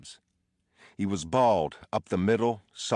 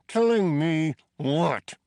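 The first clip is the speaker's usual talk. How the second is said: higher, louder repeat; angry demand; 11 semitones higher, 6 dB louder, slower than usual. A narrator's reading. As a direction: push harder the second time. Prisoner repeats, escalating.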